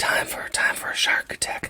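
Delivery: hushed unvoiced whisper, like a show sting; segment whisper. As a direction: whisper it like a spooky jingle.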